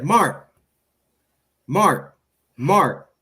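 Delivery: imitating a mother repeatedly calling, insistent and separated; mom calling out. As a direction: call a son who is ignoring you. Mom calls her ignoring son.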